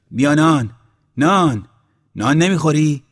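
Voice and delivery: loud, high luring call; calling to lure. A narrator's reading. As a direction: call out the bait word by word. Luring hungry man with bread. (صدا زدن بلند برای وسوسه)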